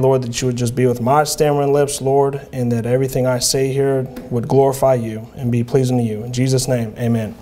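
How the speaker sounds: low, even, subdued praying voice; prayer. Real, not acted. Preacher asking the Lord for help before preaching, prayerful.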